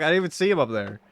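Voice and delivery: ordinary delivery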